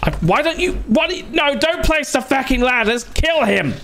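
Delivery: high-pitched frantic yelling; frantic anger. Real, not acted. Gamer yelling at the game to kill an attacker, frantic anger. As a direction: squeal orders in exasperated panic.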